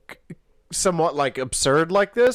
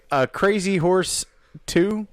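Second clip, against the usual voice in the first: ordinary delivery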